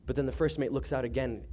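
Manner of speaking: ordinary talk, nothing marked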